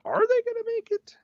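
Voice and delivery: very high, squeaky questioning voice; tiny worried voice, hammed up; mock suspense. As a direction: squeak out the doubt. Voices the viewer's doubt in squeaky pitch.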